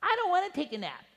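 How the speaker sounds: put-on high childish whine; whiny preschooler. Preacher imitating a preschooler complaining about naps. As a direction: whine like a tired little kid. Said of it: "Preschoolers complain".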